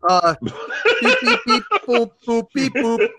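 high beeps, laughing through them; goofy laughing dial-tone noises; silly clown. Pretend. Dialing preseason Rob again.

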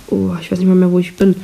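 ordinary talk

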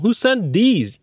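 "these" high and strong, falling; stress on THESE. Shown as a demonstration. Teacher's third version, stress on "these". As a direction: land the weight on "these". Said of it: "و حالت سوم".

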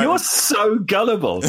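laughing, slow and high: you're so gullible; laughing tease. Real, not acted. Teasing his cohost for believing the lie, laughing.

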